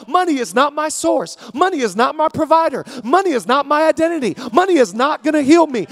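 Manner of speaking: loud, rising sermon cadence, hammering each line; preaching at full force. Acted. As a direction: preach it to the rafters, building. Preacher builds to a climax.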